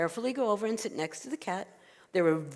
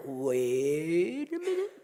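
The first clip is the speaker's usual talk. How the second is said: very slow, drawn-out wait; exasperated old woman; 3 semitones lower, much slower than usual. Acted. Old woman fed up with the noisy bed, exasperated. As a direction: stretch it out in rising disbelief.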